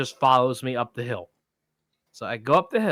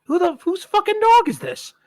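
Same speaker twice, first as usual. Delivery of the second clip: high, exasperated outburst, much higher; exasperated disbelief; 4 dB louder than usual. Retold or imitated. Stuck with a stranger's Great Dane, high exasperated outcry. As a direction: throw up your hands, who owns this dog. Baffled about whose dog.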